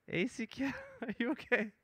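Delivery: very high soft pet-talk voice; cooing at cat. Real, not acted. Streamer cooing at his just-woken cat, high and soft. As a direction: coo up high like talking to a kitty.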